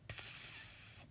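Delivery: long unvoiced psst; baby's psst noise. A narrator's reading. Baby refuses its lunch, long hissed psst. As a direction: let out a softened, sustained hiss. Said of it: "It said".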